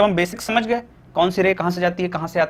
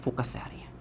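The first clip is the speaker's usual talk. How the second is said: a soft unvoiced prompt for the class; whispered answer; 5 semitones lower, 13 dB quieter than usual. Shown as a demonstration. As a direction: whisper the whole answer back. Teacher answers own question softly.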